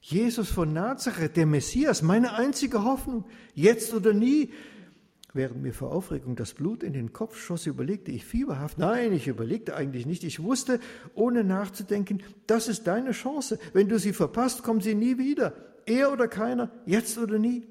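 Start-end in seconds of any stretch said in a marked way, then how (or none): 0.0-4.5 higher and louder, breathless hope; rising excitement. Acted. Blind beggar hearing Jesus passes, excited hope. Beggar realises his chance. 10.2-17.7 high, loud, driving inner voice; urgent excitement. Acted. Beggar urging himself to seize his chance, urgent excitement.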